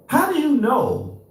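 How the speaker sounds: high-pitched, indignant disbelief; incredulous rant, pitched high; exasperated challenge. Real, not acted. Exasperated rant at the letter's claims.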